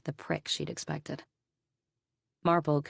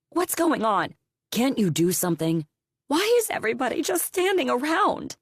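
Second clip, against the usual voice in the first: loud, pleading questions, rising higher at the end; desperate clamor; 9 semitones higher, 6 dB louder than usual. Acted. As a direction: demand answers in a panic, louder. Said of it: "the desperate parents clamoring for answers".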